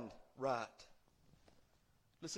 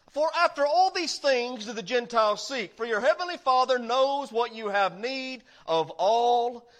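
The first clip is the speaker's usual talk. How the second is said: loud, higher preaching read, climbing in force; full-force preaching; 6 semitones higher, 10 dB louder than usual. Acted. Preacher booming scripture to the congregation, full force. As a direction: preach the verse out to the whole church.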